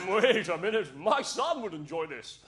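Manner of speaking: lightly voiced English father having an idea; hint of a hammed-up English gent; southern English dad. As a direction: start loud, then slow down as a musing English dad. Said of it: "and thinking".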